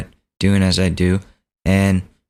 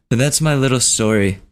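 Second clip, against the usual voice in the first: usual speaking voice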